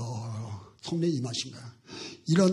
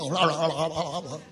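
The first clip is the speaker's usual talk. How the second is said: mock drawn-out wail; 6 semitones lower, 9 dB quieter than usual. Mock wail after the protest.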